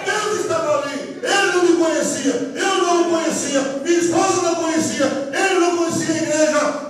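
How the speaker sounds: high, driving sermon cadence; impassioned pulpit crescendo; full-force preaching. Real, not acted. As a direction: pound out each phrase in a high preaching chant.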